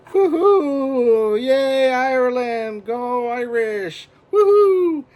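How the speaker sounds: high, loud whooping cheers for Ireland; festive cheering. Real, not acted. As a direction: cheer like it's a parade. St Patrick's Day cheering to camera.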